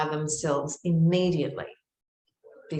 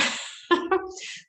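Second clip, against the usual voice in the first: high breathy laugh. Real, not acted. Laughing at her own panic.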